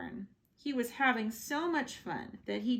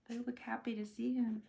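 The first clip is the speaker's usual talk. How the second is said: plain reading voice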